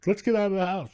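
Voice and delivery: put-on high, fast girly voice; movie character voice. Quoting a Boogie Nights bedroom scene, high girly voice. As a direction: lightly play a coked-up girl from the film. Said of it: "And she goes".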